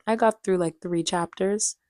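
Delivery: normal talking voice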